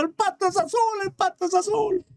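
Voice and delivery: very high, thin mock shout; happy donkey shouting. Donkey leaving happy with the verdict, high gleeful mock shouting. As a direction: squeal it in triumph without real volume. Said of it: "sale contento gritando".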